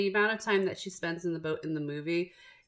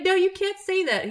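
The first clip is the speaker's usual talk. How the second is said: higher, louder disbelieving protest; shocked protest; 13 semitones higher, 6 dB louder than usual. Retold or imitated. Wife protests her husband's crude movie summary, shocked. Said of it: "I'm like".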